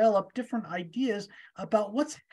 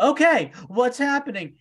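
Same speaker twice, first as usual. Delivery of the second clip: anxious Moses; 6 semitones higher, 5 dB louder than usual. Retold or imitated. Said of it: "Moses looking up at God and going".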